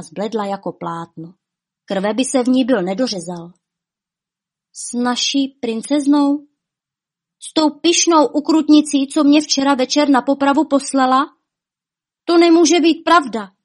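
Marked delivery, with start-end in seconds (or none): 4.7-13.5 higher, louder, appalled disbelief; high-pitched, upset incredulity; shaken disbelief. A narrator's reading. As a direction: protest in shock, voice raised. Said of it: "Markice se ruce roztřásly".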